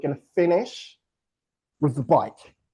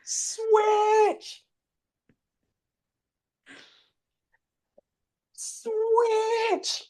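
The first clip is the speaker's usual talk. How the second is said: high sung-out Switch; called-out command. Shown as a demonstration. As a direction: call it out like a coach. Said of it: "calling switch in race".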